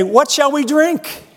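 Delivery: high, slow whiny complaint; grumbling people. Retold or imitated. Israelites grumbling to Moses, high and whiny. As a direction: whine it like a thirsty crowd. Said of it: "grumble to Moses".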